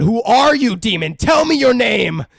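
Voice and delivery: hammed-up priest demanding an answer; mock exorcist shout. Priest confronting the demon, loud mock command.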